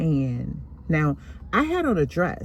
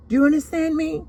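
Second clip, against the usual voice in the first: higher, louder stern demand; stern scolding; 12 semitones higher, 4 dB louder, faster than usual. Retold or imitated. Grandmother scolding the little girl, stern. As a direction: lay down the law to a child.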